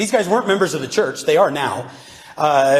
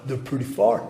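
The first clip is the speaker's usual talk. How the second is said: mock McCoy reply; 5 semitones lower, 5 dB quieter than usual. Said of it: "He's like".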